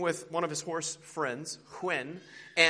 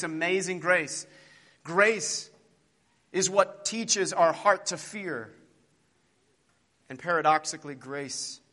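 prayer. Acted. Preacher praying aloud for the congregation, reverent. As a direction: pray earnestly before the people.

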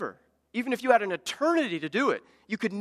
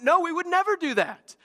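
high, louder indignant denial; adamant protest; 11 semitones higher, 4 dB louder than usual. Retold or imitated. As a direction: insist you'd never do it, higher and louder. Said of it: "they get adamant".

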